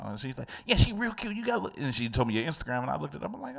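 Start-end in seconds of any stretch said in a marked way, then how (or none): 0.7-1.8 put-on nasal New York accent. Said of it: "a nasally New York accent".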